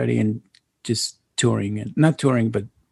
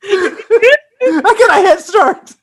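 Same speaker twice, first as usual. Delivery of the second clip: high squeaky laughing cry; 7 dB louder, much slower than usual. Real, not acted. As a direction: choke out words through laughter. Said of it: "I got the laugh attack".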